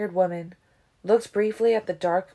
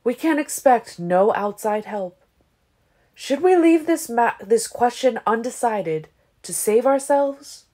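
loud, higher, oratorical questioning; clear address; 5 semitones higher, 6 dB louder than usual. A narrator's reading. Aro asks his guard to decide, clear-voiced. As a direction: put it clearly to the whole assembly. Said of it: "addressed them in a clear voice".

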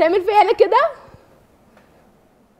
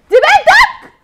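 high, loud shouted accusation; furious shout; 11 semitones higher, 9 dB louder, much slower than usual. Acted. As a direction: scream the question at him. Wife confronts husband about daughter.

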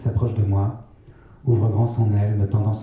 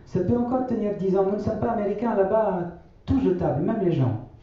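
grand-mère, voix bien plus aiguë et douce; 12 semitones higher than usual. A narrator's reading. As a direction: prendre la voix haute de la vieille grand-mère. (grand-mère qui répète sa maxime aux enfants, voix aiguë)

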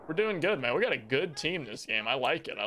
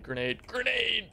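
grenade warning yell; 6 semitones higher, slower than usual. Real, not acted. Grenade incoming in game.

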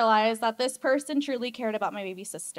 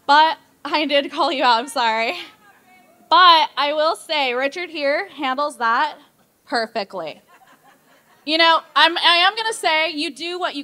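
loud, laughing call-out of her sister; loud teasing; 3 semitones higher, 10 dB louder than usual. Real, not acted. Maid of honor teasing her sister at the toast, loud and laughing. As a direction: tease the bride loudly, half laughing.

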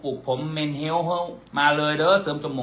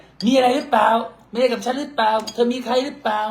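high-pitched nagging partner voice, louder; mimicking the partner's jealous questioning, high and loud; mock suspicious partner; 9 semitones higher, 3 dB louder than usual. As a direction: whine like a jealous partner checking on you.

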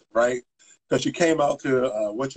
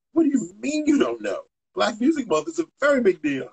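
high-pitched, retold disbelief; incredulous protest; 10 semitones higher than usual. Young staffer to colleagues in a meeting, incredulous. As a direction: push back in mock disbelief, pitch jumping up.

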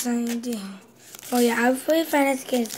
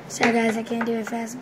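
ordinary talk, nothing marked

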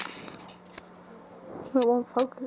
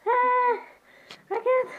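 squeaky high helium voice, much higher; 10 semitones higher, slower than usual. Real, not acted. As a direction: gasp it out full of helium. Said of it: "eating helium again".

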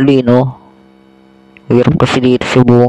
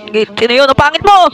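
teasing yell; 12 semitones higher than usual. Real, not acted. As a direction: shout the taunt up high. Teasing shout during game. (pasigaw na pang-aasar)

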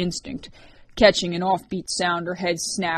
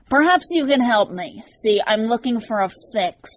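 sly, nasal stranger, pitched up; dark nasal snicker; 5 semitones higher, 6 dB louder than usual. A narrator's reading. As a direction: sneer it through the nose. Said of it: "a dark, nasally voice".

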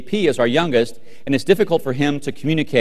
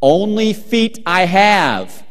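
put-on slow, loud, higher child's voice; earnest child; 5 semitones higher, 6 dB louder, much slower than usual. Young son answering dad about his shoes, earnest and slow. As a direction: imitate a child's drawn-out, wholly sincere answer. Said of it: "with absolute sincerity and honesty".

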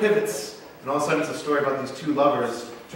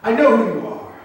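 higher, louder accusation; accusing princess; 8 semitones higher, 6 dB louder than usual. Retold or imitated. Captive princess confronting her masked captor, accusing. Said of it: "she says these words".

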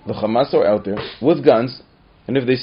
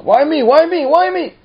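much higher, louder mimicking; mock whiny yell; 8 dB louder than usual. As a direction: imitate the kids' whining. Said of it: "very upset".

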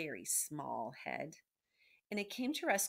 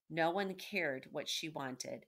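plain reading voice

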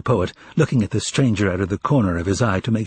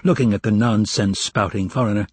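usual speaking voice

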